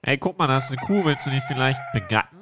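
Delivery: normal talking voice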